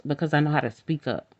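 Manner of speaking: normal talking voice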